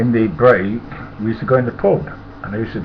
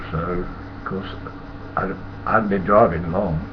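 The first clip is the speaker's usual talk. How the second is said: ordinary delivery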